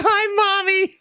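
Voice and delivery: put-on high childish call; kid yelling for mommy. Retelling the boy greeting his mom, high child voice. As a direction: sing out Mommy like a little kid. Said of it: "He's yelling".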